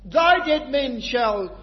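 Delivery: drawn-out, loud, high recitation; slow fervent scripture reading. Acted. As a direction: read each word slowly like a proclamation. Scripture quote read with fervour.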